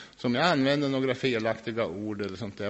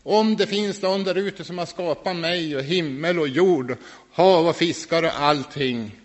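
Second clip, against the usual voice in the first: loud, high cry flung at the sky; shouting out loud; 6 semitones higher, 7 dB louder than usual. Retold or imitated. Man in the woods shouting to God for help. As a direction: call out to whoever made the world. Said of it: "jag skrek rakt ut".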